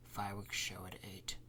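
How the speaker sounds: reading quietly. Acted. Councilman reading the schedule to himself, whispered. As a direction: murmur it to yourself. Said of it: "he reads quietly".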